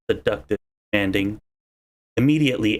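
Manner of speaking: ordinary talk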